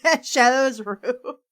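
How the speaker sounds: ordinary delivery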